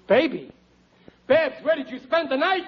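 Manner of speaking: high-pitched, scandalized questioning; shocked alarm. Acted. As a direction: demand an answer in fatherly alarm. Alarmed father questions daughter.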